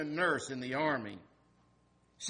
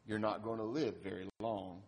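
softer, lower, gentle doctor's words; said gently; 5 semitones lower, 6 dB quieter than usual. Acted. Doctor gently tells his patient, lower and softer. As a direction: break the bad news tenderly. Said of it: "Doctor Phillips said gently".

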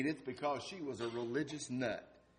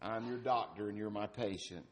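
softer, lower, gentle doctor's words; said gently; 5 semitones lower, 6 dB quieter than usual. Acted. Doctor gently tells his patient, lower and softer. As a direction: break the bad news tenderly. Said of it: "Doctor Phillips said gently".

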